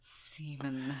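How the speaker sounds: "Semen" softly, with a long hissed S; snake-like S. Shown as a demonstration. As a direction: hiss the first sound of "Semen" like a snake. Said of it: "I sound like a snake".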